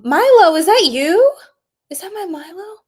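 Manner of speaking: high, loud, gushing call to her pet; light up at seeing your dog; delighted pet greeting. Real, not acted. Streamer gushing over her pet Milo, high and delighted.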